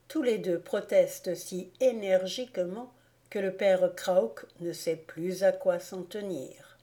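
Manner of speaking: ordinary delivery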